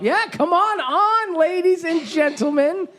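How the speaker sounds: high, drawn-out host's call; stretched-out show welcome; calling guests on. Real, not acted. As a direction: usher them in with a slow, high holler. Host calling next guests on.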